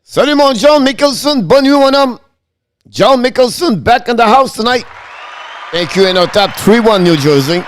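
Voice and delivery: high, bright-up announcer welcome, calling out to a returning fan; 常連の視聴者に呼びかける高めの興奮した挨拶; excited hype greeting. Real, not acted. Hypes the returning viewer, French greeting.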